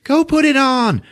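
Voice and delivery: high, slow gushing mom voice; mock mother cooing over cute pajamas; imitating mom. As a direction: coo like a doting mother. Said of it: "the mom is just like".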